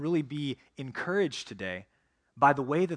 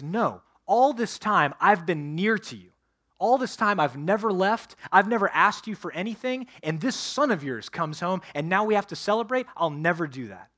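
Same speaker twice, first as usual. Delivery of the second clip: louder and higher, indignant complaint; resentful older brother; 4 semitones higher, 5 dB louder than usual. Acted. Older brother refusing his father, loud and indignant. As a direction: hurl the grievance at your father.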